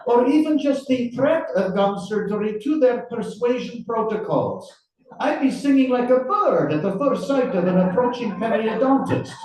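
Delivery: high, projected Russian accent attempt. Interrogator quoting the writer's article back, in a Russian accent. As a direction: quote it out in a put-on Russian accent, pitched high. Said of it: "your Russian accent".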